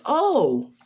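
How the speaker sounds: high-pitched surprised greeting; high character voice on the phone; Nezu. Acted. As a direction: pitch leaps up on recognising the caller. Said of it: "Nezu says".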